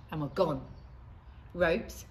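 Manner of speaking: plain reading voice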